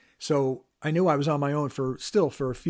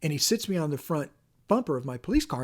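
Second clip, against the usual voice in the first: ordinary talk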